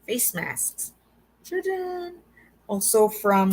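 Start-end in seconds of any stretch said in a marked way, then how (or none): 1.4-2.1 high, light reveal flourish; showy ta-da. Real, not acted. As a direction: present it like a magic trick. Unveiling the face masks.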